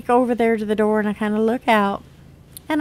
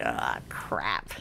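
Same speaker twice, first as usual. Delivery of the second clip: whispered, much lower and softer; dismayed mutter; 13 dB quieter than usual. Retold or imitated. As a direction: mutter it under your breath. Mother's dismay, aside.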